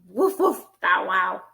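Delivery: two different dog barks, playful; puppy barks. Acted. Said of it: "Lots of good dog sounds".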